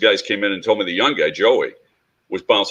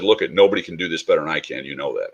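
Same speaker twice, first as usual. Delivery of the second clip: usual speaking voice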